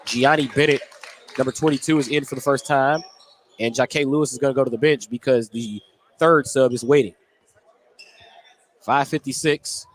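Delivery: ordinary talk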